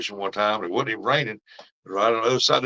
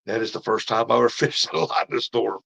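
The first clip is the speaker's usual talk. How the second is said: ordinary delivery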